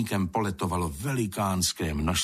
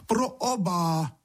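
high, drawn-out call; exclaiming; 10 semitones higher, slower than usual. A narrator's reading. Two friends correcting the old sprite, calling out high. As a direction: call it out slowly for deaf ears. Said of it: "zvolali kamarádi".

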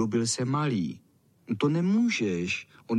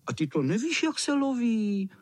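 scornful; 10 semitones higher than usual. A narrator's reading. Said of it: "Řekli opovrženě ostatní".

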